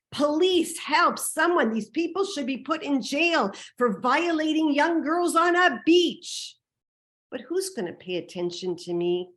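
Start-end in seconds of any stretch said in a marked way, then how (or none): 0.1-6.6 high, loud cry for help; retold yelling. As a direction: call out in outrage, stretching the final words. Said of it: "I start to scream and yell".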